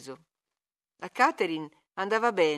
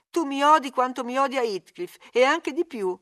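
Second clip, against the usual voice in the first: higher and louder, on the edge of tears; upset Catherine; 6 semitones higher, 6 dB louder than usual. Acted. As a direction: accuse him through tears. Catherine accusing in distress. (Catherine sconvolta, più acuta e forte)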